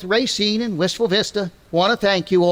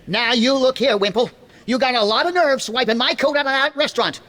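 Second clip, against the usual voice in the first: blustering tough talk; 7 semitones higher than usual. Acted. McGee getting tough with Wimple.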